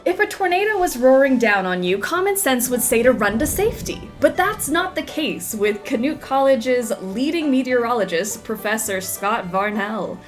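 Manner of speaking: TV reporter delivery; news report. Acted. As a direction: narrate like a news segment. In-game TV report.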